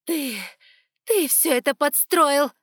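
loud, high, slow fury; furious accusation. Acted. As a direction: hurl the accusation. Priestess accuses archon.

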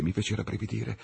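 usual speaking voice

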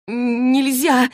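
high, breath-caught outcry; gasping protest. Acted. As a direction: choke out a protest, pitched high. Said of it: "Клэри аж задохнулась". (задыхаясь от возмущения)

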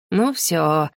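understated resigned dismay; dismayed gasp. A narrator's reading. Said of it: "охнула Мари". (тихое оханье с досадой)

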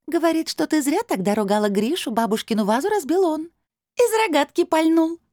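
high, mischievous voice; tittering crystal voice. A narrator's reading. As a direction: tell tales gleefully from up high. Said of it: "хихикнул голос". (высоким голосом, с хитрецой)

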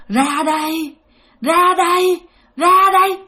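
ghost luring, rising to a loud call. Acted. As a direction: call them out like a grinning demon. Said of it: "nó cười, nó kêu".

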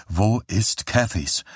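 slow, subdued but persistent; repeated question. A narrator's reading. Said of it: "fragte Johnny noch einmal". (langsam und gedämpft nachgefragt)

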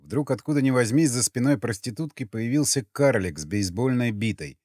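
usual speaking voice

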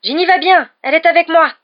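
high, very loud call; shouting good news. A narrator's reading. Harry reassures Ron across the rockfall, shouting. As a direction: shout the relief across a distance. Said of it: "cria Harry en accélérant le pas".

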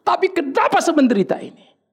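high-pitched cry to God; pleading believer. Acted. Sufferer asks God why it hurts, high pleading. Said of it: "Lalu kita bilang".

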